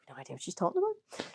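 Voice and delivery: soft, quick, innocent murmur; angelic innocence. Retold or imitated. Gaslighting her friend, soft innocent denial. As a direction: mutter it like butter wouldn't melt. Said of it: "like an angel".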